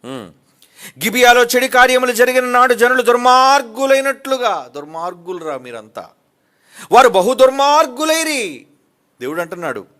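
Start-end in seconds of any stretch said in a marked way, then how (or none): 1.0-4.7 loud scripture reading. Acted. 6.9-8.7 loud scripture reading. Acted.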